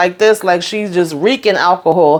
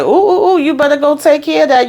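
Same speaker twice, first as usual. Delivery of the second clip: mocking glee; 8 semitones higher than usual. Mocking him as pipes spray.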